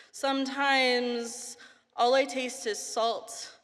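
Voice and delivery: spoken-word poetry performance; poem. Acted.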